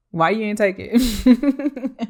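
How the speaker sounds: laughing. Retold or imitated. Asking the ex why he refused, slow and laughing. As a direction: drag it out, amused and incredulous. Mock complaint to the ex.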